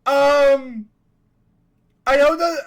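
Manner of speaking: laughing, very high, shouted; startled scream-laugh; shocked. Real, not acted. Real shock at fourth-wall notification.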